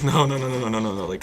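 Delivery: alarmed shout. Retold or imitated. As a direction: jolt them before they doze off. Keeping drunk girls awake.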